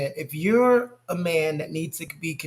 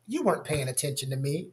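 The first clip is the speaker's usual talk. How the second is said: soft realization; 5 semitones lower, 8 dB quieter than usual. Retold or imitated. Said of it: "She's like".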